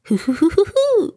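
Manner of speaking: Tigger's hooting laugh, jumping high on the last hoos; Tigger's bouncy laugh. A narrator's reading. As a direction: hoot out a gleeful Tigger laugh. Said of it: "he said".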